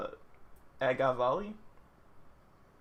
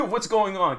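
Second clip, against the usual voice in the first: louder, higher imitation of a bystander; mock alarm; 4 semitones higher, 7 dB louder than usual. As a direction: blurt it in mock panic after a laugh. Repeats the bystander's question, amused.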